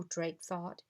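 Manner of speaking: ordinary delivery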